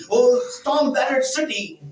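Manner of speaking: slow, solemn Bible reading; scripture reading. Real, not acted. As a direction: read the verse slowly and solemnly. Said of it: "while I read in English".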